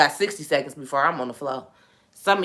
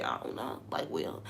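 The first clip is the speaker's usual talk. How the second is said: near-whispered, softer and lower; calm and quiet; 13 semitones lower, 14 dB quieter than usual. Retold or imitated. Answering her mom about the collapse, nearly whispered. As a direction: hush it, unconcerned. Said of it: "I'm talking literally like this".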